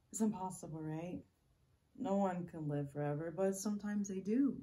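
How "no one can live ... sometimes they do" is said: loud, lifting at the end; forceful, rising delivery; emphatic insistence. Acted. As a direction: push it out insistently. Acted monologue, emphatic second take.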